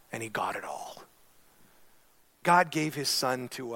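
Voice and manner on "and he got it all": whisper. Acted. Hushed climax of point.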